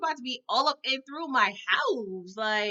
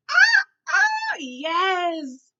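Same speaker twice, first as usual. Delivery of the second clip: much higher, much louder squeal of delight; near scream of joy; 8 semitones higher, 8 dB louder, much slower than usual. Retold or imitated. Watching Uncle Phil show up, squealing with joy. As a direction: shriek like the best scene ever. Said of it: "I almost screamed at the screen".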